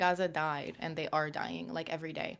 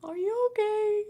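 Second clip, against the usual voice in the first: imagined concerned mom; 5 dB louder than usual. Pretend. Mom walking in on Bible reading, high mock-concerned voice. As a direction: put on a louder, high-pitched mom checking in. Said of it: "She's gonna be like".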